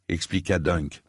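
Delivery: usual speaking voice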